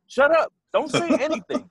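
high-pitched exasperated mock order; urgent retold hushing. Man silencing his ex before police arrive, high urgent re-enactment.

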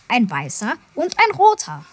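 ordinary delivery, nothing marked

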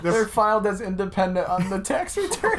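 high silly voice. Pretend. Joking, high voice.

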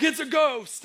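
high, loud cry of alarm; mock fright. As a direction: act out a spooked disciple. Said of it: "disciples are freaking out".

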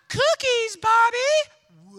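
high, loud singsong mom calling her son; mock commercial mom. Commercial mom calls Bobby to the cookies, high and loud. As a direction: squeal it out like a cheery TV mom. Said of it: "She's like".

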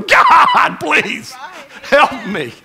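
loud, cracking high plea; desperate cry for help. Retold or imitated. Someone on their knees begging God, loud and breaking. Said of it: "slide ... up next to your bed going".

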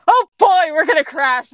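loud, high cry of alarm; mock alarm. Doctor warns companion of a crash, high loud alarm. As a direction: call it out as the ship goes down.

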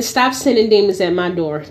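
ordinary talk

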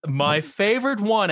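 usual speaking voice

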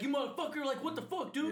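high, mock-outraged cursing; cussing out. Angry customer cursing the bartender, mock outrage. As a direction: imitate an irate customer's outburst. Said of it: "he called you a motherfucker".

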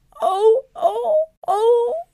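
high, loud, acted wailing; sobbing. A narrator's reading. Wife finding she has wings for arms, sobbing. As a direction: wail each cry like a hammed-up sob. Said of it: "snikte mevrouw Krijtjes".

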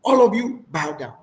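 high-pitched, hammed-up royal outrage; angry king. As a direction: play a king issuing an angry ultimatum. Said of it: "The king was angry".

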